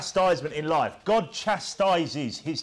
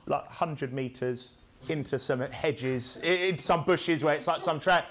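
ordinary talk, nothing marked

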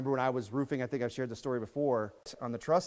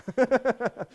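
loud amused laugh; 11 semitones higher, 7 dB louder than usual. Real, not acted. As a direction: burst out laughing.

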